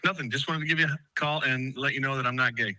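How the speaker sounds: normal talking voice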